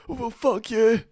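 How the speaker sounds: high mock-raging retort, not shouted; pure raging. Imitating the wee guy's reaction in the van, raging. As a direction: act suddenly outraged, pitch jumping up. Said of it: "He gets pure raging".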